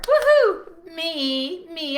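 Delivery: high, loud whoop of excitement; excited cheer. Real, not acted. Host cheering for the live group, super excited. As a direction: let out a big, loud woohoo. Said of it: "I'm super excited".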